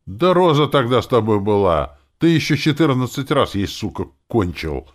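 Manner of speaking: loud, high, worked-up accusation; excited outburst; agitated. A narrator's reading. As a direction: blurt it out heatedly, slowing at the end. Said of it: "возбуждённо сказала девушка".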